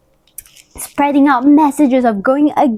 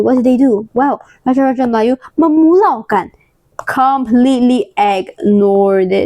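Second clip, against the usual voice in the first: usual speaking voice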